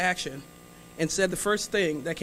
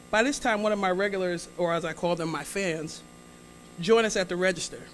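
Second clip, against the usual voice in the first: ordinary talk, nothing marked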